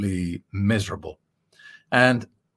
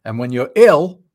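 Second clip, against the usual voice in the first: ordinary delivery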